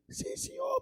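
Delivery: soft squeaky henchman; falsetto reply. Acted. Watchmen obeying the boss, falsetto reply. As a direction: answer obediently, soft and very high.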